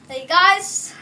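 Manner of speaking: ordinary talk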